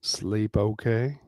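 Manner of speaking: slow, low suggestive question; low thick voice. Acted. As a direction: ask it slow and heavy. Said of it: "voice low and thick".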